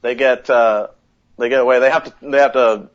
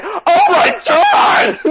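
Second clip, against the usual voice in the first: much higher, slow, drawn-out shout; shocked yell; 4 dB louder, much slower than usual. Retold or imitated. At the game's ending cut, a stretched shocked yell. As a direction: let the shock burst out long and loud. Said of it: "I was like".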